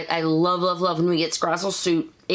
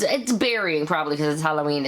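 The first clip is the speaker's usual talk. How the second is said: usual speaking voice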